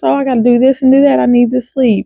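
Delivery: quick, high-pitched excuse-making; imitating your own fussy protest; mock whining. Imitates past self making excuses.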